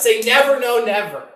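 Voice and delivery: "never, no, never" pitched high and loud; insistent mock vow; emphatic never. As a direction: punch "never" hard, then repeat it. Mocks own earlier vow.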